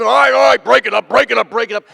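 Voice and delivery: loud, high crowd-breaking order; imitated commanding shout. Fake guard to two wrestling men, commanding shout. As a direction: take charge, playing the guard stopping a brawl. [name] jumps into a fight.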